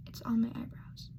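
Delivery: whispered to herself mid-story; a quick breathy whisper about her makeup; whispered aside. Real, not acted. Vlogger doing her makeup mutters a whispered aside.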